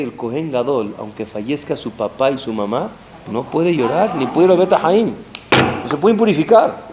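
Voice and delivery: ordinary delivery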